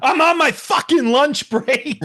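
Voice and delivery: loud, slow, high mock-exhausted worker; a clerk whining that he is on his lunch break; fed-up clerk. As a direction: drag it out like an overworked clerk at his limit. Joke clerk voice, riffing on story.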